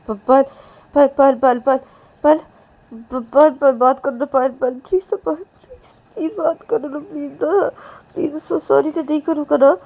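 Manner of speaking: high, loud, voice cracking in tears; weeping apology; sobbing plea. Acted. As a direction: beg through sobs. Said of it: "Ananya रोते रोते".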